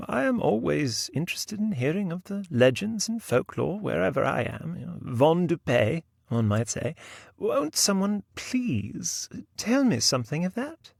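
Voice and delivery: high-pitched, eager lady novelist; British lady novelist. Acted. Novelist asks the company for local legends, high-pitched lady's voice. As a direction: put on a high, politely imploring novelist's voice.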